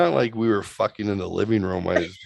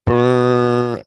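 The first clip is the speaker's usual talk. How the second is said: garage door noise; 4 semitones higher, 8 dB louder than usual. Retold or imitated. Imitating the garage door opening.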